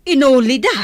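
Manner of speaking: loud, high alarmed question; shocked. Acted. Nana asking in shock if someone entered, alarmed. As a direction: demand it in shock.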